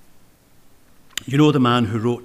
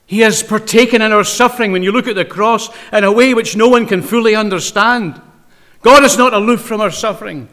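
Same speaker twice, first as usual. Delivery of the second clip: preaching hard; 6 semitones higher, 8 dB louder than usual. Real, not acted. Preaching at full force.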